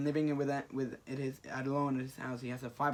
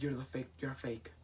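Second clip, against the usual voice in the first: quiet rejection; 3 semitones lower, 9 dB quieter than usual. Retold or imitated. Crushed Peter rejects clone, retold.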